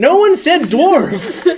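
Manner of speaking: slow, high, laughing protest; can't keep a straight face; laughing denial. Real, not acted. Player denies the word dwarf, high with laughter.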